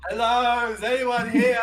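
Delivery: calling out loud and high; shout. Acted. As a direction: call out into the empty shop. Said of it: "Big Dave shouts".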